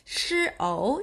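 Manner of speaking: ordinary talk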